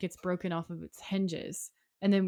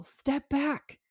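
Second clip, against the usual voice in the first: high-pitched imitation of a panicked order; retold cry to back off; mock yell; 8 semitones higher than usual. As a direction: act out a sudden warning, pitched up. Sheriff's panicked warning, acted.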